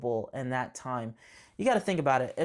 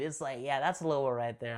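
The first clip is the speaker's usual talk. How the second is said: ordinary delivery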